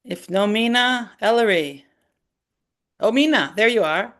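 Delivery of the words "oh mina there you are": ordinary delivery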